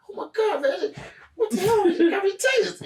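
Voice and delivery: very high mock wail; whining tased man. Tased man to his wife, mock-distressed whine. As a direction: wail it like a comic victim.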